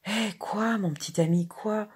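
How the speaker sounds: mild indignant outcry; exclaiming. A narrator's reading. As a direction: exclaim it as a protest at the question. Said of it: "Il s'écriait".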